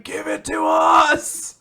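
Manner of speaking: high, drawn-out fan shout. Retold or imitated. Host imitating somebody in a roaring crowd, drawn-out shout. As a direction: scream the demand from the crowd. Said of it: "There's somebody just shouting".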